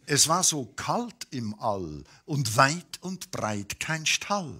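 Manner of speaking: ordinary delivery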